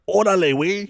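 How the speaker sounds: big, loud, high Mexican holler; Mexican slang call. Retold or imitated. Host showing what you'll hear from Mexicans, loud Mexican call. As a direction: shout it as a Mexican greeting. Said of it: "If they're from Mexico".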